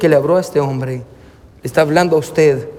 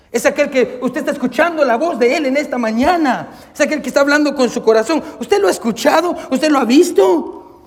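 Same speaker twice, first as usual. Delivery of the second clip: preaching appeal; 8 semitones higher than usual. Acted. Appeals to the listeners.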